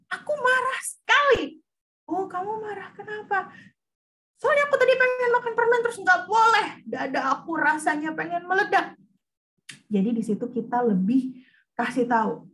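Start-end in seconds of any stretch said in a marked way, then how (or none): 0.1-1.6 put-on high, loud child saying it is angry. Child voiced in example dialogue. 2.1-3.5 higher, gentle questioning mother; mother asking. Pretend. Parent voiced in example dialogue. 4.4-8.9 put-on high, loud complaining child; upset child voice. Child voiced in example dialogue.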